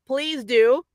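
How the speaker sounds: ordinary delivery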